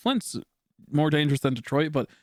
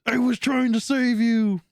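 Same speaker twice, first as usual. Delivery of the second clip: pleading justification; 9 semitones higher, 3 dB louder, slower than usual. Retold or imitated. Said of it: "I'm like".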